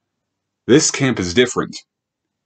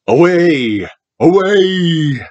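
shouting; 7 semitones higher, 4 dB louder, slower than usual. Acted. Child reads a character's shout.

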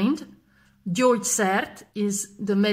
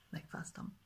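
whispered; 23 dB quieter than usual. Shown as a demonstration. Whispered repeat for learners.

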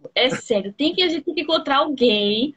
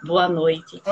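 romantic voice; 7 semitones lower than usual. Pretend. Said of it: "Aquela voz romântica".

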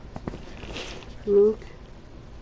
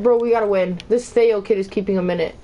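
ordinary talk, nothing marked

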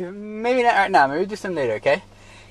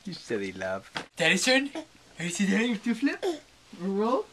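usual speaking voice

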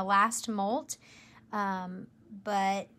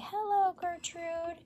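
higher and softer, sweet coaxing greeting; gentle coaxing hello; 7 semitones higher, 6 dB quieter than usual. Shown as a demonstration. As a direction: coax a shy spider out gently. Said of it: "I'm gonna very gently just say".

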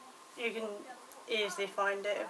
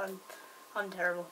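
usual speaking voice

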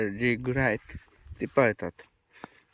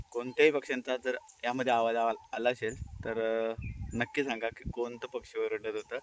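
softer voice so the birds come through; hushed; 6 dB quieter than usual. Real, not acted. Vlogger telling viewers to listen to the forest birds, hushed voice. Hushing so birds can be heard.

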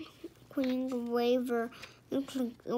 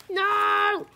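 loud, high, angry shout; shouted refusal; 8 semitones higher, 9 dB louder than usual. A narrator's reading. King Arthur refusing the knight, shouting. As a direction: shout it down flat out. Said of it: "shouted King Arthur".

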